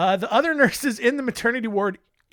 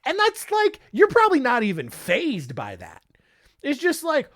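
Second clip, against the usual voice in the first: ordinary talk, nothing marked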